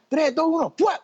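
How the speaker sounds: conteo agudo imitando al productor; cuenta regresiva. Retold or imitated. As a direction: canta la cuenta para salir al aire, en agudo. Productor corre dando la cuenta regresiva. (productor contando antes de grabar)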